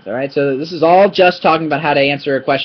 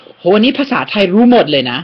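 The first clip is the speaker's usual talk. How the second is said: mock Thai compliment; 5 semitones higher, 3 dB louder, much faster than usual. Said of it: "When they stop saying".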